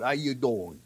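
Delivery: low, soft mock New York greeting; New York accent. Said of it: "doing my New York accent pretty good".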